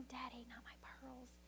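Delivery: put-on frightened little girl whispering; fearful whisper. A narrator's reading. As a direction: whisper it, scared to lose the pearls. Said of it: "she's almost fearful".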